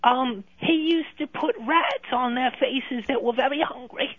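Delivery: put-on a middle-aged Londoner's British accent, a bit brash. British-accented ex ringing the radio show to scold Tommy. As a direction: play a cheeky London ex-girlfriend in British accent.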